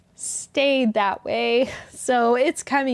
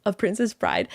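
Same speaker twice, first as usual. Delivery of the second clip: usual speaking voice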